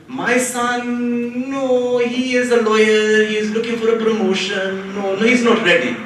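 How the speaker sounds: put-on auntie brushing it off, Indian accent. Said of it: "her voice changed".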